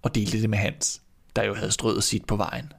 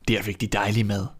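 plain reading voice

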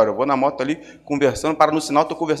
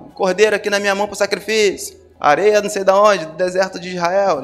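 higher, louder street-seller call; imitated vendor's cry; 5 semitones higher, 4 dB louder than usual. Preacher mimics temple traders selling sacrifices, calling out like a hawker. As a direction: hawk the goods over a market crowd. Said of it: "gritando lá".

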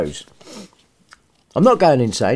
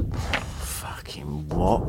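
whispered, slow baffled aside; 14 semitones lower, 13 dB quieter, much slower than usual. Real, not acted. Narrator baffled by the text, whispered aside. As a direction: breathe the words out in disbelief.

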